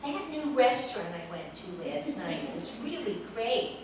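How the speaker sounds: ordinary delivery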